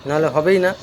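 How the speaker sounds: ordinary talk, nothing marked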